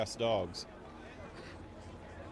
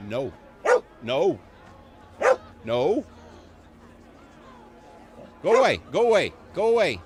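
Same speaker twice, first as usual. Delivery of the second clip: louder, firm go away to the dogs; scolding dogs; 3 semitones higher, 7 dB louder than usual. Real, not acted. Owner scolding two big dogs, louder firm warning. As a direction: shoo them off and threaten.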